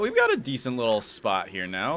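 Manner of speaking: ordinary talk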